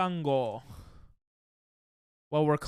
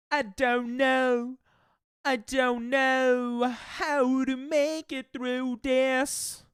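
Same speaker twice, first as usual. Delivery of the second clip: slow lilting mock British; drawn-out British singsong; British accent; 7 semitones higher, much slower than usual. British accent attempt singing.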